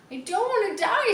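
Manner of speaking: high, loud desperate scream; terrified pig screams. A narrator's reading. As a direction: scream it out in despair. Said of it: "screamed Wilbur".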